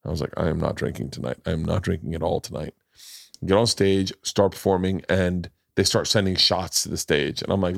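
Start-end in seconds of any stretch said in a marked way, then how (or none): 0.4-2.7 low, quiet self-talk; private resolve. Retold or imitated. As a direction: lower and softer, firmly telling yourself. Retelling his private resolve.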